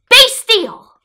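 high, loud sharp order; commanding shout. Acted. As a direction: shout down a pack of monsters. Max commands the wild things.

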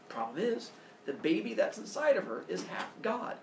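usual speaking voice

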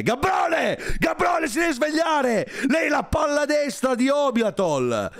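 high, slow mocking yell; heckling shout. Real, not acted. Streamer yelling at a silent guest to wake up. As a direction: shout him awake from the sofa.

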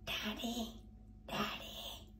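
soft unvoiced whisper. A narrator's reading. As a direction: whisper through the window. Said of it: "whispers Peppa through the window".

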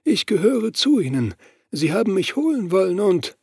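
a strange, otherworldly high voice, hushed; Gary Ross voice. Acted. Gary Ross's voice again.

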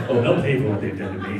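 hint of a bitter Nixon vow; Nixon impression. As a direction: mutter a grudge like a sulking Nixon. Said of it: "Richard Nixon or something".

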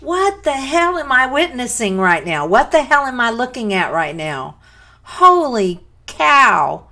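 loud, high, real astonishment; astonished. Real, not acted. Viewer stunned by a singer's performance, loudly astonished. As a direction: exclaim in disbelief at full voice.

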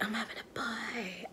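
unvoiced hushed whisper of a secret. Mocking her own wrong guess in a whisper. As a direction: breathe it out as a whisper. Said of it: "sis was like".

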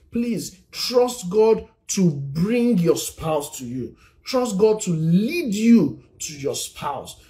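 slow, drawn-out pleading preach; slow tender appeal from the pulpit; earnest slow exhortation. Acted. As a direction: plead with the congregation to trust God.

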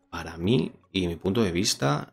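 voz de presentador, pausada; reseña a cámara. Acted. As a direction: cierra el vídeo con la opinión. Closing review to camera. (tono de reseña)

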